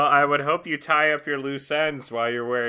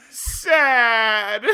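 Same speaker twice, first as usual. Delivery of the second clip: mock sad; 9 semitones higher, 6 dB louder than usual. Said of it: "then he says".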